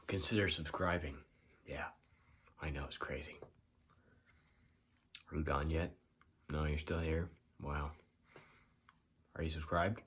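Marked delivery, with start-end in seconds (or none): none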